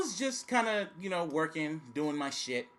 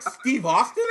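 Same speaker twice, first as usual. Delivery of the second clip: "Austin" rising, louder and laughing; amused, louder double-take; surprised Steve Austin; 3 semitones higher, 6 dB louder, slower than usual. Retold or imitated. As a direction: retell the disbelieving "Steve Austin?" bigger. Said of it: "I was like".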